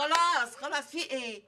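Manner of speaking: sharp loud stop to calm her; loud cut-off. Acted. Friend loudly cutting off a rant with a sharp stop.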